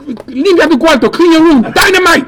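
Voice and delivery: hammed-up mom barking orders at her kid; imitating mom. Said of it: "When she's like".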